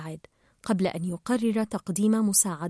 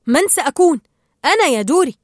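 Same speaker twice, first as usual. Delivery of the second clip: loud, raised-pitch retort; exasperated reply; 5 semitones higher, 8 dB louder, faster than usual. A narrator's reading. Marlin reminds Dory who he is, loud exasperated reply. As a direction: answer loudly with impatience.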